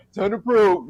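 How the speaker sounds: slow, drawn-out alien voice. Retold or imitated. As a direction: drawl it like a spaceman. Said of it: "see the alien voice".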